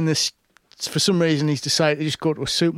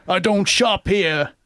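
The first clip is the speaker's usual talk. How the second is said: slow, cool mock Stallone line; Stallone one-liner; 4 semitones higher, slower than usual. Friend quoting the action film's one-liner, slow and cool. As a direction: drawl it like a tough-guy hero. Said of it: "Stallone turns around and goes".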